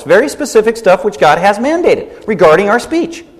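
ordinary delivery

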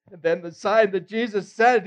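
ordinary talk, nothing marked

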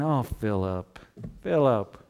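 a soft, sorrowful sigh of a name; disappointed. Retold or imitated. Jesus gently disappointed in Philip, sighing softly. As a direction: let the letdown sit in the name. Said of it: "with maybe a little bit of a disappointed voice".

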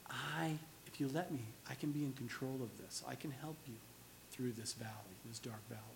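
very soft, low, hushed voice; soft and calming, fading almost to nothing; gentle reassurance. Acted. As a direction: quietly comfort someone who is anxious. God calming the anxious, voiced.

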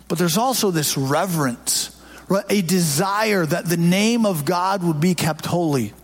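usual speaking voice

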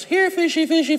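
put-on little girl fish call. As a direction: call the fish like a small child. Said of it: "She goes".

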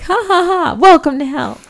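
high, loud hammed-up demon laugh then welcome; mock demon cackle. Podcast host acting the film demon greeting a soul, hammed-up high cackle. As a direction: cackle like a gloating cartoon demon.

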